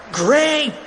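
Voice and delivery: high-pitched cartoon yell; high excited shout. A narrator's reading. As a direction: yell approval high and urgent. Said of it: "yelled Fluffy".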